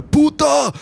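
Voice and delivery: high, loud screamed insult; boy shouting. Retold or imitated. Boy in the sea yelling at his mother, loud high shout. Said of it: "så roper han bare".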